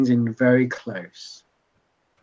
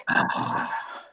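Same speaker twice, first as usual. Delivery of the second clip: knowing bartender; slower than usual. Acted. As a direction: start with a breathy "Ah", then stretch each word. Bartender's grave revelation in game.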